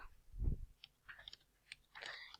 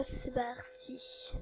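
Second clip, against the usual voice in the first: whisper; 12 dB quieter, much slower than usual. Real, not acted. Starts game round in whisper.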